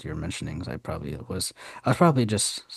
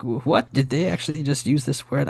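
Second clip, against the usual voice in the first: retold disbelief, pitch raised; shocked; 7 semitones higher than usual. Reacting to the show using an Arabic word, shocked. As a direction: replay your stunned reaction, voice pitched up. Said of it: "so shocked".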